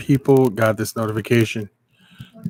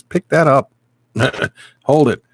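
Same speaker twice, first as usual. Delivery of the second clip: inner voice; 4 semitones higher, 5 dB louder than usual. Retold or imitated. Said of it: "Something in my head says".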